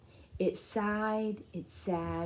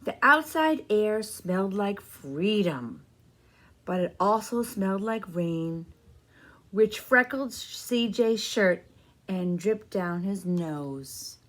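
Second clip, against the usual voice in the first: put-on slow, gentle read-aloud for kids; storybook narration; 3 dB louder, slower than usual. A narrator's reading. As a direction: tell the story to a room of children. Reading picture book to children.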